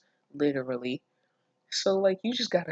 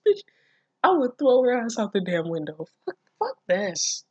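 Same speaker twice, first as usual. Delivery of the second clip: ranting; 6 semitones higher, 4 dB louder than usual. Real, not acted. Ranting about the character.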